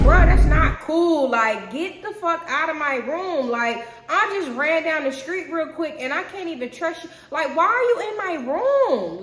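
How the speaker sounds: high, loud and slow angry telling-off; furious scolding. Retold or imitated. Scolding his brother for using his room, loud angry yell. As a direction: lay into him, outraged and disgusted.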